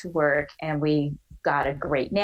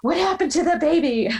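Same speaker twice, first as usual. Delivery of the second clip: high, louder panicked question; freaked-out mom; 11 semitones higher, 5 dB louder than usual. Retold or imitated. Baby-nurse mom asking her sobbing daughter, panicked. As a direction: imitate a mother jumping to the worst. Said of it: "it totally freaked her out".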